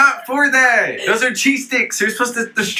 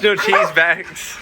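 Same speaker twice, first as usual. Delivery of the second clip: ordinary talk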